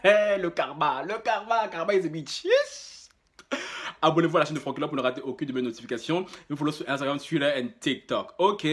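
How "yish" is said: very high squeaky yish; high squeal. Real, not acted.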